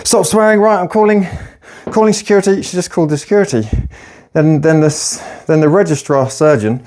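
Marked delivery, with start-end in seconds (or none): 0.0-2.6 higher, sharp scolding nurse; stern nurse. Retold or imitated. Nurse scolding a swearing patient, higher stern voice. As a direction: threaten to call security, pitched up. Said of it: "nurse threatens security".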